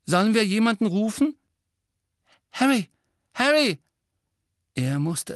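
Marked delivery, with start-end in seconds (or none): 0.1-1.4 high, loud, quick and anxious; frightened voice. A narrator's reading. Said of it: "eine ängstliche Stimme". 2.5-3.8 loud, high shouts; sharp cries to get through to him; urgent calling out. Acted. Friend calling out in alarm.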